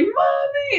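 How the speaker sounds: high, hammed-up terrified yelp; mock scream. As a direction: squeal it like a scared kid. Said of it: "it's so funny".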